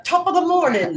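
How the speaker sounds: light mock Irish brogue, pitched high. Friend teasing about reading cards in a mock Irish brogue. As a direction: toss off a cheeky stage-Irish greeting. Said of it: "reading it with the brogue".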